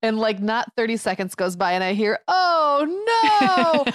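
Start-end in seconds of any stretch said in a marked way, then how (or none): 2.3-3.8 high, loud wail of oh no; a kid's mock cry of dismay. Child's dismay as the egg spills, high wail.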